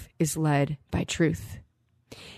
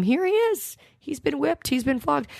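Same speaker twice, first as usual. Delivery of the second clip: much higher, mock-showy voice of Pilate; Pilate presenting Jesus; 10 semitones higher than usual. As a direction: lift the pitch way up, showing off the prisoner. Preacher voicing Pilate presenting Jesus.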